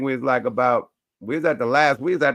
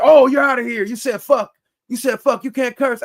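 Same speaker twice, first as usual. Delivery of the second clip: higher, louder retold guard telling him off; mock angry guard; 11 semitones higher, 7 dB louder than usual. Security guard kicking him out for cursing, mock higher louder yell.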